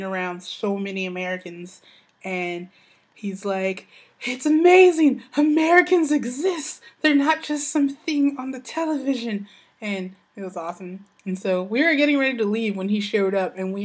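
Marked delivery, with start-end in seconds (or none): 4.2-9.5 high, loud wonder; amazed drunk excitement. Retold or imitated. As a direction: gush like a drunk who just met Americans. Drunk Scottish guy marvelling.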